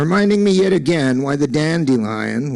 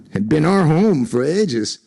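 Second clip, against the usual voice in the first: ordinary delivery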